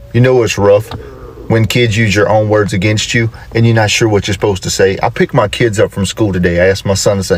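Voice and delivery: normal talking voice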